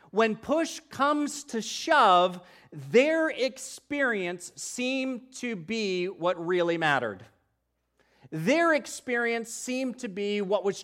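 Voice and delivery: loud, slow, fervent preaching; preaching at full force. Real, not acted. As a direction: drive each phrase home from the pulpit.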